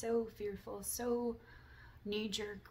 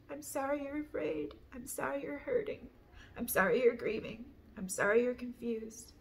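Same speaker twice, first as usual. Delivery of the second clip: raised, insistent rhythmic recital; apology litany; 4 semitones higher, 5 dB louder than usual. Real, not acted. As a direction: recite each apology in the same lifted cadence. Chants a list of apologies.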